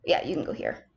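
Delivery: breathy half-whisper, low and soft; quiet unvoiced aside; muttered aside. Real, not acted. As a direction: mutter it under your breath. Placing items, muttered aside.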